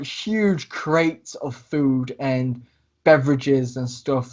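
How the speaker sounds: ordinary talk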